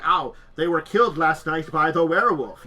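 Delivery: booming werewolf game narrator; mock announcement. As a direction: announce the death like a game host.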